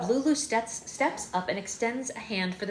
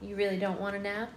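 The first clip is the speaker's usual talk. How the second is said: usual speaking voice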